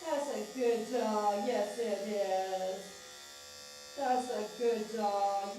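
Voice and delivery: slow, drawn-out crooning praise; soothing praise. Real, not acted. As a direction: stretch each word out to calm the dog. Praising the dog for staying calm.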